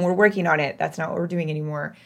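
usual speaking voice

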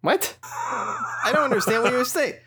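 high-pitched baffled protest; baffled by a literal-minded droid; exasperated. Acted.

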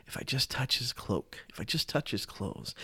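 softly, to herself; a secret, hushed wish; hushed inner thought. Retold or imitated. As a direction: murmur the hope under your breath. Woman's hidden hope in crowd.

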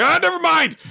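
high loud flustered cry; flustered squeal. Retold or imitated. Girl backing out of going with him, flustered high squeal. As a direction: blurt it out, panicking. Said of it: "she's like".

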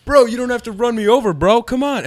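high, hurried mock plea; pleading protest. Man begging a departing driver not to hit him, pleading. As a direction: protest loudly as the man nearly run over.

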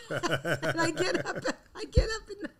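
high, breaking up with laughter; real helpless laughter. Real, not acted. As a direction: try to tell it through your giggles. Helpless laughter while telling.

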